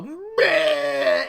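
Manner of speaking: high, loud bleating growl; silly drawn-out beast cry; mock beast roar. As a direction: bleat like a badly faked monster.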